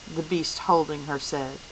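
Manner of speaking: ordinary delivery, nothing marked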